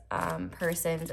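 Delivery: ordinary talk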